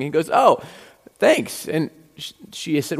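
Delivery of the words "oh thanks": put-on bright, higher little boy's voice; child voice. Teller voicing his son thanking a lady, higher child voice. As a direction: chirp it like an innocent kid.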